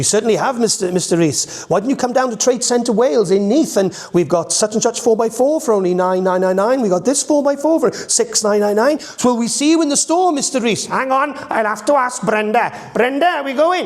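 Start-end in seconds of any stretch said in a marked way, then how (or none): 1.7-10.8 higher, bright salesy pitch; Stacey pitching four-by-fours to Mr Reese; Stacey advert voice. Shown as a demonstration. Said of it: "So we had Stacey". 10.8-13.9 high, broad Welsh farmer; sing-song country Welsh, much higher. Shown as a demonstration. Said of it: "Mr Reese asks Brenda".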